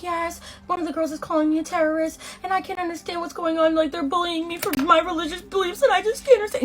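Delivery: high whiny tattling voice; high mock-hurt complaint to the RA; whiny victim. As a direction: play the wronged victim, voice way up. Ellen whining to RA, high.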